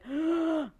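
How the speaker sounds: a quick demo gasp for breath, mid-pitch; panting gasp. Shown as a demonstration. Group talk on anxiety, speaker demos a gasp for breath. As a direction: gasp in like you just ran. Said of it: "I'd be breathing hard".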